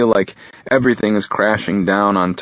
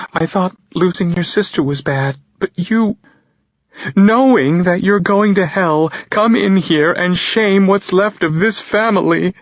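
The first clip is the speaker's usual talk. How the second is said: tearful mother; 12 semitones higher, 5 dB louder than usual. Acted. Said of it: "tears begin to fall".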